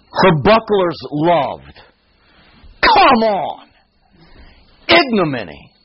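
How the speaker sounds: exasperated spoken reading, high punch on "Come on!"; slow lead-in, then a high exclaimed "Come on!"; passionate colloquial outburst. Shown as a demonstration. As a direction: say it off the page, with feeling. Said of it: "if you could hear me say it".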